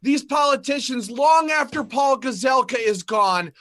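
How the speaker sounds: loud, high rallying shout. Real, not acted. Rallying gun owners against politicians, loud and high. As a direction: proclaim it like a rally cry. Rallying speech at full force.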